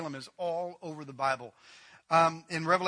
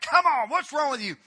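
raised, higher mock yell at the donkey; angry rebuke; 7 semitones higher, 6 dB louder than usual. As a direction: snap at a stubborn donkey. Balaam beating his donkey.

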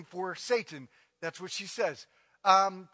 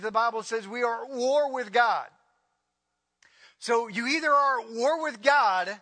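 higher, emphatic preacher's build; fiery preaching; 4 semitones higher than usual. Real, not acted. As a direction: drive the point home from the pulpit. Preaching at full force.

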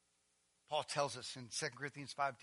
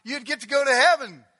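higher, louder mock pleading voice; mocking legalist; 8 semitones higher, 18 dB louder than usual. Pastor mocking what Jesus didn't say, loud parody. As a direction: parody a fussy rule-maker. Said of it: "He didn't say".

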